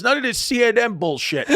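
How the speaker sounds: high-pitched dismissive outburst; indignant rant. Retold or imitated. Funeral director ranting at mourners, indignant. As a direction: imitate a worked-up man scoffing.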